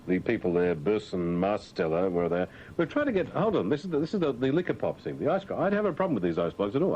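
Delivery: normal talking voice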